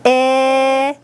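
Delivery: "I" as a held short i sound, as in "it"; syllable isolated. Shown as a demonstration. Teacher giving the next syllable to pupils, one sustained sound. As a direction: hold the short vowel "I" on its own. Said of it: "What's our next syllable?".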